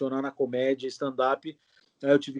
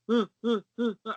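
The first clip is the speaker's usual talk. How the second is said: short, high-pitched wordless hums; clipped run of higher "hum" noises; mute character's grunts; 7 semitones higher than usual. Shown as a demonstration. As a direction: answer only in grunts, like a mute. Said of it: "fazia um mudo".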